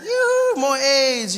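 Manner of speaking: high singsong yoo-hoo call. Retold or imitated. Playful singsong calling.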